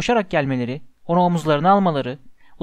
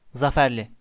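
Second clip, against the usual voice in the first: plain reading voice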